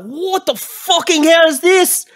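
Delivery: high, loud alarmed yell; startled NPC shout. Acted. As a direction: jump and shout in shock. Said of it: "he instantly turns around and is like".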